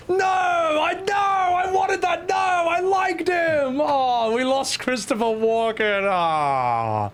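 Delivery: high, drawn-out mock wail; hammed-up lament, long vowels; mock grief. Mock grief at character's death.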